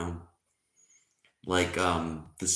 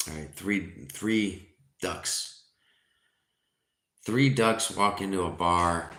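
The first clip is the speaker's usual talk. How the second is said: ordinary delivery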